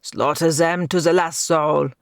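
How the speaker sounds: raving villain. A narrator's reading.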